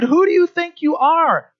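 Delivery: high, indignant demand; mocking challenge. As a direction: sneer it like an offended critic. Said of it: "and they said".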